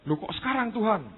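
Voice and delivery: high, pleading protest to God; Yusak protesting. Retold or imitated. Young Yusak protesting to God, high and pleading. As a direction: whine up high like a startled young man.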